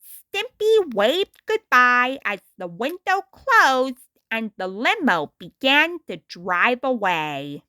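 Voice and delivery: ordinary delivery, nothing marked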